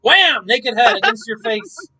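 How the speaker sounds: loud playful war cry; mock battle shout. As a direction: yell it like a brawler. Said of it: "and you're like".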